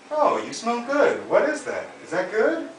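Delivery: high, slow rising upstroke voice; mock the rising upspeak; upstroke imitation. Shown as a demonstration. Coach mocks Hugh's upspeak greeting, high and slow.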